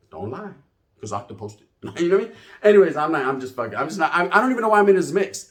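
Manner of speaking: ordinary talk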